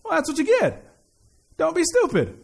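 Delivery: high-pitched, dismissive dad retort; put-on fatherly exasperation; mock scolding dad. Acts out dads scolding the child.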